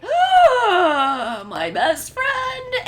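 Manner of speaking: high, loud, drawn-out mock wail; anguished wail. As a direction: scream out in hammed-up grief. Ochako cries over fallen friend.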